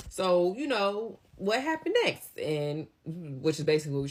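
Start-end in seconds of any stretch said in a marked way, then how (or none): 0.1-2.4 eager to hear more. Retold or imitated. Eager listeners pressing for more.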